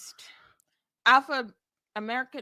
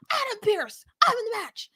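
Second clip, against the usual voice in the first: high mock Rhea; 12 semitones higher than usual. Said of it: "She said".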